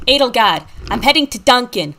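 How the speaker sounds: put-on Boston accent. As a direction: play it broad, like a Southie local. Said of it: "talks in an extremely thick Boston accent".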